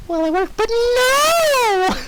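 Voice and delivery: high, slow fake sob; hammed-up high pony sob; hammed-up crying Pinkie. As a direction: wail it in a badly faked cry. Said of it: "that was horrible".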